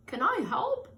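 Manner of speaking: high eager offer to help; Mister Seahorse asking. Acted. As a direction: lift the voice, keen to help. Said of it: "asked Mister Seahorse".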